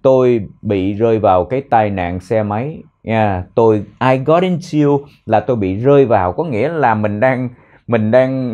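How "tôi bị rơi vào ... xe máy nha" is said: usual speaking voice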